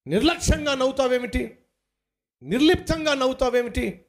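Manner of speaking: high, impassioned rhetorical questions; fervent preaching. Real, not acted. Preacher to the discouraged listener, challenging their laughter, impassioned. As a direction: press each question home with fire.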